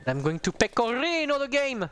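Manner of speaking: mock Italian accent. Streamer jokes to chat in a mock Italian accent. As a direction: ham up an Italian accent like a chef. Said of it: "why am I saying it with that accent".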